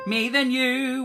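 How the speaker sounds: usual speaking voice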